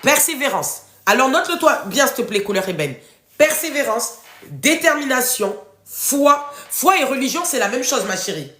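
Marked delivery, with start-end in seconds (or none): none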